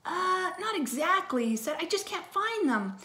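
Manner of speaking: high, hesitant excuse; sheepish admission. A narrator's reading. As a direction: admit it like a boy in trouble. Boy admitting he lost them.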